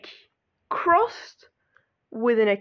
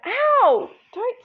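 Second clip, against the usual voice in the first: just got slapped; 10 semitones higher, slower than usual. Real, not acted. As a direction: yelp from a slap. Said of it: "Don't slap me".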